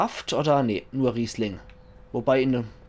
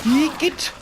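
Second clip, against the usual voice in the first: much higher, louder mock-terrified squeal; hammed-up panic; 6 dB louder than usual. Leslie's voice played for laughs, begging the monster to leave, in a hammed-up shriek.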